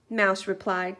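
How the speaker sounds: ordinary delivery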